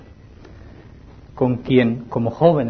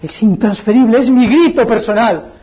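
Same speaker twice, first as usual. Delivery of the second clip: louder, urgent appeal; fervent preaching; 6 semitones higher, 4 dB louder than usual. Acted. Preacher urging young people, fervent call. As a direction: drive it home.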